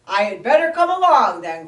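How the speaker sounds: loud, quick gruff bear voice; growling bear. Acted. Bear insists on joining the search, gruff. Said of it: "growled the bear".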